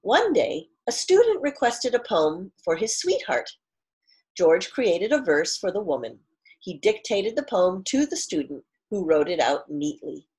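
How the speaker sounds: clear, fuller read-aloud storytelling voice; story narration. A narrator's reading. Teacher reads picture book aloud.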